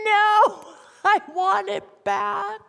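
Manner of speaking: high, loud no, then a slow whiny plea; imitated tantrum whine; whining child. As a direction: play a kid who just lost his game. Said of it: "this is the response".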